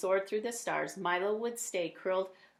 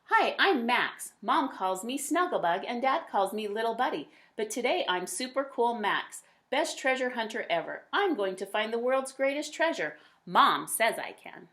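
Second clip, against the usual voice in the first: lively, louder storybook voice; reading to children; 2 semitones higher, 4 dB louder than usual. A narrator's reading. A parent reading a picture book aloud to kids, lively. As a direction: read it brightly to young listeners. Reads child narrator Max.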